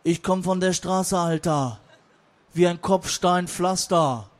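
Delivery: slow, loud street-rap swagger; dark-voiced gangster rapper. Gangster-Rap takes the floor to threaten everyone, dark-voiced. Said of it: "mit seiner dunklen Stimme".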